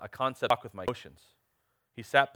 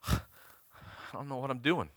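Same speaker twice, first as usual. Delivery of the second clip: heavy sigh, then a softer admission; imitated weary confusion; 7 dB quieter than usual. Preacher quoting a brother in his forties, mock weary sigh. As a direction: sigh it out like someone at a loss.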